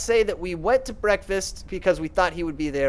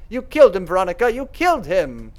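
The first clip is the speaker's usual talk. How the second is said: accusing shout; 3 dB louder than usual. Acted. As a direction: throw the blame at her. Clarissa accuses Veronica of murder.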